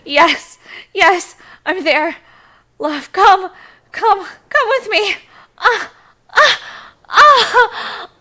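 loud high cries; climax cries. Acted. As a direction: cry out at the peak.

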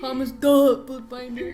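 mocking shout. Mocking the name loudly.

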